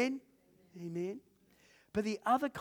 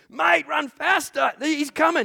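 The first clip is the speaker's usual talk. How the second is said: higher, louder, slow urgent yell; retold urgent shout; 13 semitones higher, 13 dB louder, slower than usual. Stranger shouting to flee from the dog, retold urgent yell. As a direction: warn them to run, hammed up. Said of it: "and then he shouts".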